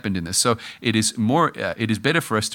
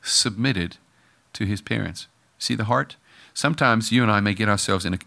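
usual speaking voice

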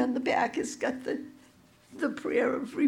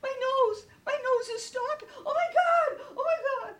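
high-pitched, overwhelmed disbelief; imitated amazement; 13 semitones higher, 3 dB quieter, faster than usual. Retelling the healed man's reaction to his nose, amazed. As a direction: exclaim it in high astonished wonder.

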